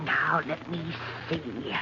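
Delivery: whispered to herself, scanning the page; breathy murmur as she reads; under her breath. Acted. Martha searching the obituaries, whispering.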